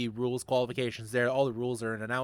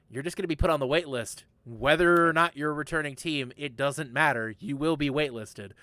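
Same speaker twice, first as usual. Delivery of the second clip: normal talking voice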